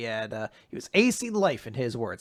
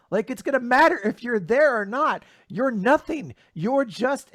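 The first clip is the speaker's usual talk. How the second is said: higher, incredulous acted scolding; indignant tirade; 7 semitones higher, 5 dB louder than usual. Seke berating Asta for getting up, indignant tirade. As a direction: build into a loud, disbelieving rant. Rival berates battered hero.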